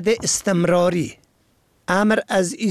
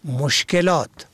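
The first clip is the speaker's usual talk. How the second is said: normal talking voice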